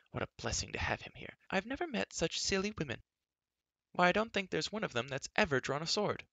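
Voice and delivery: put-on high, lively girlish chatter with swooping pitch; eager, indignant girl. A narrator's reading. Girl complaining to friends about the castle women, animated high chatter. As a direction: rattle on, exasperated by the dull company.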